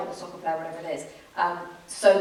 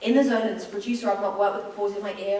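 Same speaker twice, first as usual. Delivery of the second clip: ordinary talk, nothing marked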